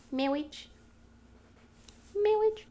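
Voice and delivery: teasing rising voice; playful fake-proposal call; mock fake proposal. Boyfriend teasing her with a mock question. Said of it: "like this".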